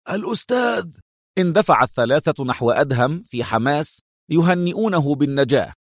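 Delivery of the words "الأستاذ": high, delighted cry without much volume; joyful exclamation. A narrator's reading. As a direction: exclaim with revived joy, pitch leaping. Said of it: "ليهتف المغربي في انتعاش وفرحة".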